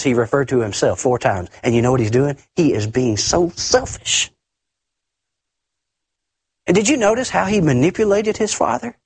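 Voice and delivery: ordinary talk, nothing marked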